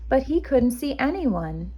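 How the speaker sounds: ordinary delivery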